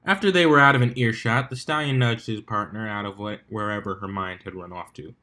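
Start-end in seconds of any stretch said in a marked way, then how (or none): none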